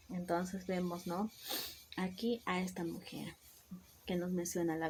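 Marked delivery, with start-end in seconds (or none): none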